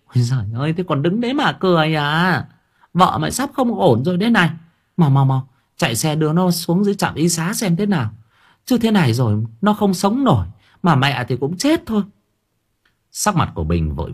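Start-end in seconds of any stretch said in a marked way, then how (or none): 0.1-12.0 much higher, urgent panicked outcry; crying out. Acted. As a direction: cry out, hurrying her son. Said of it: "bà kêu lên".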